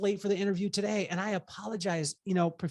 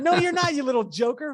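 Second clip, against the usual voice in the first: mock scolding; 7 semitones higher, 4 dB louder than usual. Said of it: "And I was like".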